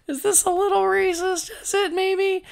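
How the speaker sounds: high, put-on voice of uneasy doubt; doubtful. To co-hosts, imitating her worried reaction, in a high put-on squeak. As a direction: squeak out a worried little question. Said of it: "I even was like".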